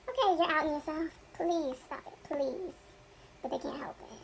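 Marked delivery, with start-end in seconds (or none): 3.4-4.2 breathy whisper, no voice; whispered aside. Real, not acted. Confiding to viewers that the couple can't help it, whispered.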